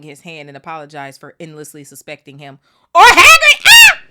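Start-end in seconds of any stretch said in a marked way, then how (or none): 2.9-4.0 high, loud burst of joy; excited shout. Real, not acted. Reader reacting to Hagrid's return, excited shout. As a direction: cheer out loud.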